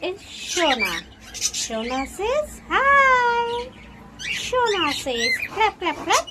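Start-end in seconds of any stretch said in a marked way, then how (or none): 1.3-6.3 1 coaxing pet talk. Real, not acted.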